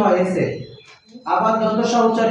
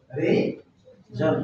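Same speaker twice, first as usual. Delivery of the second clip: Reason said very slowly and softly, stretched out; slow soft demo; 6 semitones lower, 6 dB quieter, much slower than usual. Shown as a demonstration. Teacher models the word: slow, soft, stretched reason. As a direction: ease reason out slowly and quietly for the class. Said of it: "reason না কিন্তু".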